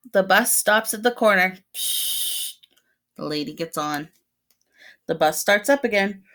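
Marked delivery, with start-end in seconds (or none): 1.8-2.6 bus door hiss. Acted. Bus door sound in story.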